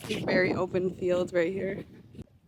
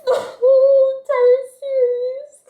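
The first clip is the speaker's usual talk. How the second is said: very high, loud laughing sob; half laughing, half crying; 9 dB louder than usual. Real, not acted. Overcome about Peeta yearning, laugh-crying very high and loud. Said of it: "I was booger crying".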